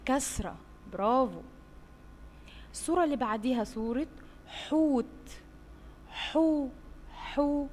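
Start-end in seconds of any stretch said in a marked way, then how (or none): 6.1-7.7 higher, exaggerated حو; syllable demo. Shown as a demonstration. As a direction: sing out حو for kids. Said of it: "صوت حرف". (نطق حو بوضوح)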